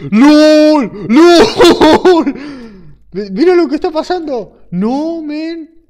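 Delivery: high, loud excited shouting; excited yelling. Real, not acted. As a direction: shriek it in disbelief. Mobs raining down.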